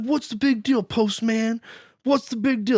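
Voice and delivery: high mock bully-kid voice; high-pitched mock complaint; bratty kid. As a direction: imitate a kid challenging the postman, pitched high. Bully kid confronts postman.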